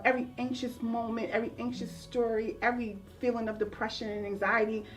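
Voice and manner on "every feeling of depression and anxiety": usual speaking voice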